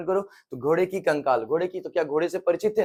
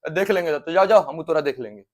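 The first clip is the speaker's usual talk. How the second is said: loud, threatening dare; teacher hamming up a street-fight threat; angry threat; 6 dB louder than usual. Acted. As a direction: bark the threat at a rival.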